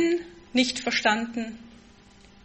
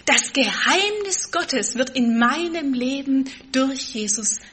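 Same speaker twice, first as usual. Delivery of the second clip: ordinary talk, nothing marked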